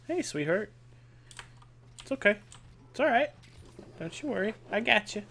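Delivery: soft, soothing sweet talk; tender reassurance. Pretend. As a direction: softly comfort someone, playfully sweet. Playfully comforting a rescued prisoner.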